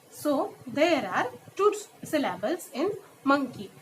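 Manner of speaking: ordinary talk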